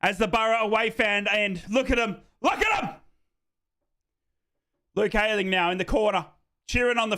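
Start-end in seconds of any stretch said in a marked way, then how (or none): 2.4-2.9 much higher, excited exclamation; excited shout. Real, not acted. Fan admiring his player, high excited shout. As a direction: burst out high in delight.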